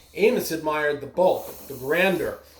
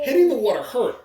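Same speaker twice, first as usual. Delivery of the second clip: louder, higher, wincing with a laugh; a touch of pain; 7 semitones higher, 5 dB louder than usual. A narrator's reading. Narrator to listeners, higher and louder as it hurts. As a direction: land it like a painful splash. Mouse smacks the water.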